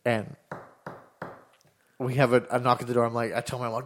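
usual speaking voice

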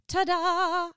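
put-on high-pitched delighted kid; gleeful ta-da. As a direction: announce it like a proud toddler. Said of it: "place of euphoria".

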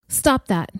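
ordinary delivery, nothing marked

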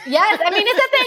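high squeal through laughter; laughing. Real, not acted. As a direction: laugh it out, pitch jumping up. Laughing at reel joke.

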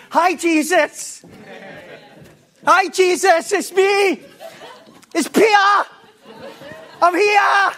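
excited, high-pitched loud calling out; восторженный Пётр. Acted. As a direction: shout it eagerly as Peter greeting Jesus. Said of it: "his enthusiasm kicks in again".

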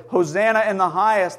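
ordinary talk, nothing marked

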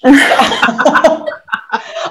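voiceless, airy laugh; breathy laugh. Real, not acted. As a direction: laugh along. Host laughs.

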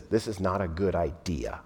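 low, soft deadpan; a hint of a dry Martha; Martha's blunt objection. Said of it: "In, in my language".

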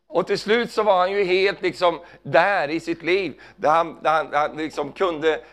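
normal talking voice

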